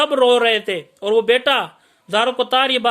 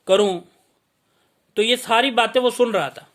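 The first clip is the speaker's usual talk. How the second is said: normal talking voice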